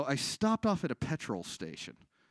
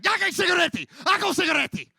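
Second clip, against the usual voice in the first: high, loud angry Slovak barking; angry old Slovak woman; 10 dB louder than usual. Retold or imitated. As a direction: snap at the customer like an old shop lady. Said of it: "she just gets mad".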